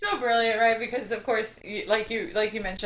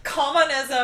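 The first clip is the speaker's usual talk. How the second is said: higher Yorkshire-style "Communism." echoed with delight; "Communism." tried in a playful Yorkshire accent; imitating Yorkshire; 6 semitones higher, 4 dB louder than usual. Host echoing the guest's Yorkshire reading, playful imitation Yorkshire accent. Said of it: "product of communism".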